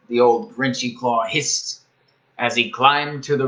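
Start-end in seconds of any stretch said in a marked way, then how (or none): none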